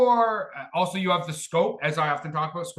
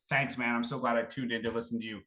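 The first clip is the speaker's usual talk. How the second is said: sarcastic listener; 6 semitones lower, 4 dB quieter than usual. Pretend. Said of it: "you're like".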